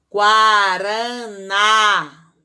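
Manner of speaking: "Guaraná" very slow and loud, gua-ra-ná; syllable by syllable. Shown as a demonstration. Teacher sounds out each syllable for the class. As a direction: drag "Guaraná" out, hitting "ná". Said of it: "acento agudo no A do na".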